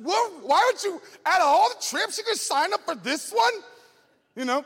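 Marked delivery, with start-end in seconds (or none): 0.0-3.5 put-on high, loud disbelieving teen; incredulous kid. Kid questioning the boy, high and incredulous. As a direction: demand why he'd sign up. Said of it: "It's like".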